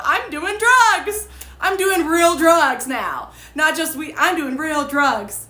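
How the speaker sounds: high, loud, drawn-out self-mockery; mock bragging. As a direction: imitate a silly show-off, stretching words. Mocks own boasting to sister.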